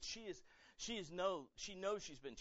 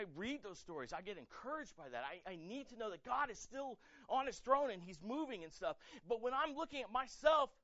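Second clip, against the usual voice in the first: usual speaking voice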